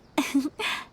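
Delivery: a short, light laugh; a little embarrassed giggle. Real, not acted. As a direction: laugh gently at herself. Brief laugh after joke.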